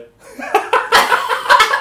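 high wheezing burst of laughter. Real, not acted. Storyteller laughing at the newspaper quote. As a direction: laugh it out breathlessly.

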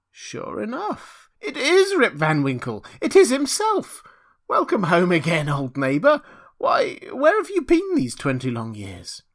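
put-on high, loud old woman's cry of recognition; old woman exclaiming. A narrator's reading. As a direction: hail a long-lost neighbour in amazed delight.

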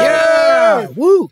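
high, loud whoop; cheering. Real, not acted. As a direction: cheer like a crowd. Guest cheering entrance.

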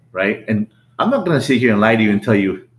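ordinary talk, nothing marked

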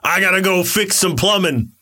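put-on broad Brooklyn working-man accent; Chris Pratt as Mario. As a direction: play a hammy Brooklyn plumber. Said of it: "but a Brooklyn accent".

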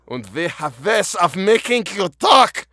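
loud, high, slow cartoon German accent; hammy German accent. Joking interrogator threatening a prisoner, over-the-top German accent. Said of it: "That's my best German right there".

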